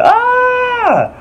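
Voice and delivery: long, very high-pitched falsetto scream; drawn-out high mock shriek. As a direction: imitate a woman screaming as she falls. Voicing the falling woman's scream.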